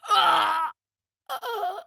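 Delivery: sharp high cry of pain. Acted. A fighter struck in battle, crying out high. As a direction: yelp as the blow lands. Pained cries in combat.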